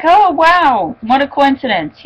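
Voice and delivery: high-pitched retold "oh wow"; mock surprise. Recapping the episode to viewers, mock surprised. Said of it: "I was like".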